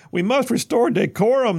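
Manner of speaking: high, loud mock outrage; mocking shout. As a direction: parody the pearl-clutchers, loud and high. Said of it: "they shouted".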